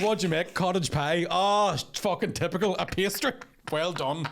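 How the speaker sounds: mock her cooking with scorn; snide teasing jab; sarcastic mockery.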